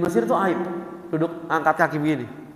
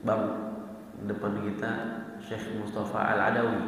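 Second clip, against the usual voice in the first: lowered, much softer whisper-like aside; bisik-bisik; 7 semitones lower, 9 dB quieter than usual. Retold or imitated. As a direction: lean in and murmur it softly. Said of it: "saya bisikin". (berbisik pelan)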